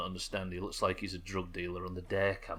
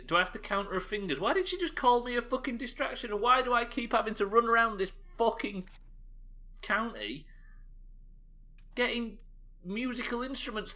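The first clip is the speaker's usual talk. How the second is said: exasperated rant; 12 semitones higher than usual. Real, not acted. Ranting about endless fetch quests.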